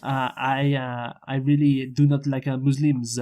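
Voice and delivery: hammed-up French accent, halting delivery; mock French accent. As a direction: ham it up as a Frenchman addressing his leader. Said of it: "some, some French accents".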